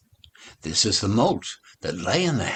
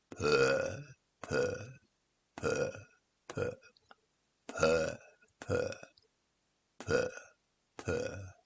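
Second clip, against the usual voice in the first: soft purring; 3 semitones lower, 9 dB quieter, slower than usual. Acted. Kittens purr contentedly.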